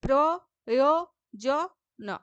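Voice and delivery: প্রয়োজন cut into প্র-য়-জ-ন, very slow; syllable by syllable. Shown as a demonstration. Teacher breaking প্রয়োজন into syllables, very slow. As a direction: tap out each syllable of প্রয়োজন. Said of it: "মানে আমার যেন কষ্ট না হয়".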